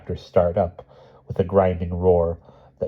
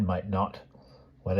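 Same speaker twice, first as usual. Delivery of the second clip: plain reading voice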